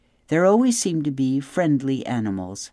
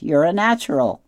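put-on higher, louder chirpy greeting from an old goose; cheery old goose; 5 semitones higher, 5 dB louder than usual. A narrator's reading. Old goose Loud Wing greeting Roz and her gosling, high and cheery. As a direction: sing out hello like a fussy old bird.

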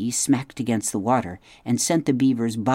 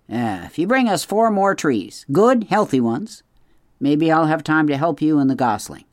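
put-on a higher, curt refusal from an old beaver; gruff beaver; 5 semitones higher, 5 dB louder than usual. A narrator's reading. Mister Beaver turns down the robot's gift, curt. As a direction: brush the offer off fast.

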